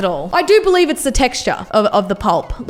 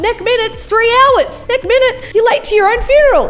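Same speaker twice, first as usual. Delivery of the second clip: mock newsreader; 10 semitones higher, 3 dB louder than usual. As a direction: ham it up like a silly announcement. Reading out a meme in character.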